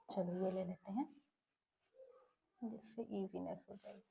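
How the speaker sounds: very quiet, low aside; half-voiced thinking aloud; talking to herself. Real, not acted. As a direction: murmur it under your breath while busy. Choosing a pen colour, to herself.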